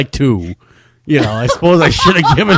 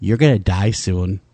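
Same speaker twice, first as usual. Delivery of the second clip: put-on low, matter-of-fact creepy child; understated ominous little kid; 7 semitones lower than usual. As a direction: imitate a kid calmly foretelling doom. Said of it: "Just that little kid voice".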